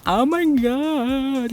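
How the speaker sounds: long, high, swooning exclamation; real gasping wonder at a reveal; awestruck delight. Real, not acted. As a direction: stretch it out in high-pitched amazement. Reveal of the palette's colours.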